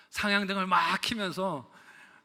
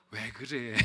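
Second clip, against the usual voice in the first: lightly voiced anxious wife; voicing wife; 6 semitones lower, 6 dB quieter, much slower than usual. Pastor voices his wife calming him in the car, lightly. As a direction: ask softly like a worried wife. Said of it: "옆에서 아내는".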